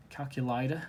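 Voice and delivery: a shaky try at an Australian accent, rising at the end; attempted Australian accent. Shown as a demonstration. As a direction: Calculator said the Australian way, ending kal-kyuh-LAY-duh. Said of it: "I'm not very good with, um, Australian accents".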